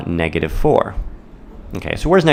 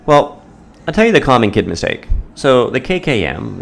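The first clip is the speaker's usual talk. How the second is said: announcing; 6 semitones higher than usual. Acted. Teacher announces the mistake.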